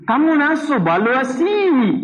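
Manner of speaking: slow, drawn-out Bangladeshi-style phrase; imitating Bangladeshi.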